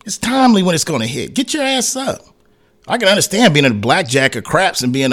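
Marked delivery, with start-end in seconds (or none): 1.3-2.2 mock urging. Urging the machine to hit.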